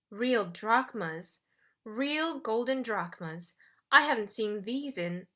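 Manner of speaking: slow, savouring each word; greedy awe. Acted. Charon hovers over the golden coins, greedy awe. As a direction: linger over the words as if coveting gold.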